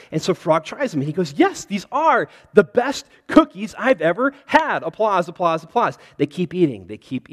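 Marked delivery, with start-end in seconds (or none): 1.3-4.8 high, loud, delighted yes; excited agreement. Acted. Frog agreeing with Toad after tasting the cookies, excited. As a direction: agree with great enthusiasm.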